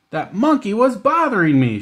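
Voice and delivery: loud, high angry shout; indignant shout, pitched high. Acted. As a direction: shout it out, furious. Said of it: "she shouted".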